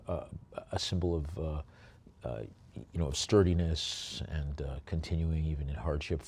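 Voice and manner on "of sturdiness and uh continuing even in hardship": ordinary talk, nothing marked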